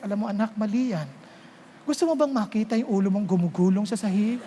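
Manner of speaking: put-on calm and soft, like talking a child round; gentle correction. Shown as a demonstration. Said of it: "Sabihan nyo with gentleness".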